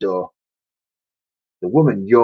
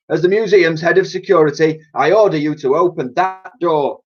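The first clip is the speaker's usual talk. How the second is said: higher blustering guard and cheeky cleaner; character voices; 9 semitones higher than usual. Acted. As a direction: boss orders, cleaner fibs back. Argument Clout and Dotty.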